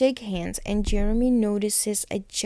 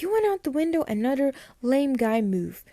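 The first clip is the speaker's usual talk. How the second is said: high-pitched mock disbelief; incredulous retort; 5 semitones higher, 3 dB louder than usual. Caroline to Matt about his window exit, incredulous retort. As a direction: throw it back as an indignant, acted question. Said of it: "And Caroline's like".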